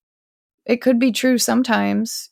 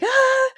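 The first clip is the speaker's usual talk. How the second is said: sharp pained yelp; 3 dB louder than usual. Retold or imitated. Imitating reaction to pain.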